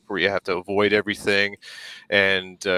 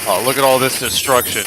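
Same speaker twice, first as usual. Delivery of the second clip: ordinary talk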